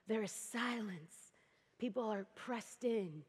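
soft, slow hushed storytelling; low, slow near-whisper of a packed room. Acted. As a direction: draw them into the silent room. Hushed scene setting.

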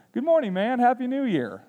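put-on a toddler parroting his father; child echoing. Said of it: "repeats the exact same thing".

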